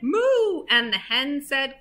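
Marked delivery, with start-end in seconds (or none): 0.0-0.7 high moo; cow's moo. A narrator's reading. Reader voices the cow in the story, high moo. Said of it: "the cow said".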